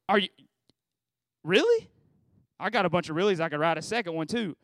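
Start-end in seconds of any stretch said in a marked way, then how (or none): 0.1-1.9 mock the doubter with a sharp "really?"; incredulous really. Mocking doubters' question.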